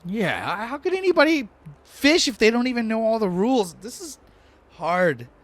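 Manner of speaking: very high, loud whine; exasperated Lortho. Acted. Lortho despairs at all the fishing rules, high exasperated wail. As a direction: wail it in hammed-up frustration. Game master voices upset Lortho.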